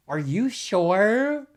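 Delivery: mock-concerned double-check; incredulous questioning. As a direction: stretch it out slowly, loudly doubting them. Retold reaction to shocking comments.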